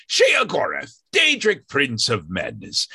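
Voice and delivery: a brief high-pitched mad prince; Sheogorath voice. Retold or imitated. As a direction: squeak out the name like a mad god. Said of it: "I'll be playing one of my characters".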